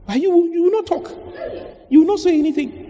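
high-pitched teasing jab at the congregation; mock accusing. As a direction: tease the silent one in a squeaky pitch. Mocking the silent member.

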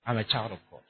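very soft, low-pitched private declaration; confessing to oneself. Shown as a demonstration. Preacher models a morning self-confession for the congregation, hushed and low. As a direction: say it low, under your breath to yourself. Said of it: "just start confessing to yourself".

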